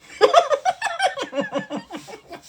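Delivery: very high, loud cackling laugh; big laugh. Real, not acted. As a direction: let out a shrieking laugh. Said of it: "I don't know why I laugh like that".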